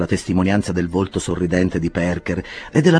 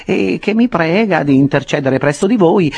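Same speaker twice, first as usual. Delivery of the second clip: higher, slow, coaxing lawyer voice; lawyer Perker, persuasive; 6 semitones higher, 5 dB louder than usual. Acted. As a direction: talk him round with solemn persuasion. Perker argues with Pickwick.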